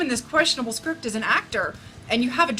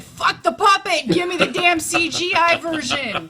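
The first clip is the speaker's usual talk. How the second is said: higher, louder demand; mock outburst; 6 semitones higher, 6 dB louder than usual. Reviewer quoting himself, loud mock demand. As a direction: bark the demand in jest. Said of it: "I actually said".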